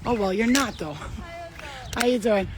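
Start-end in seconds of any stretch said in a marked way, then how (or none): none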